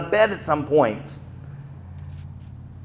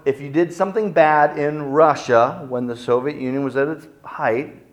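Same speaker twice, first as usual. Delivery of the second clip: normal talking voice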